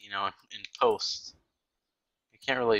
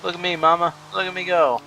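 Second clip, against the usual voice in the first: put-on higher, showing-off child voice; mock proud child; 6 semitones higher than usual. Racer calls to mama and Daddy, mock proud child voice. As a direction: call out higher, playing a proud kid.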